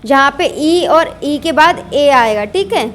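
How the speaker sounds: ordinary talk, nothing marked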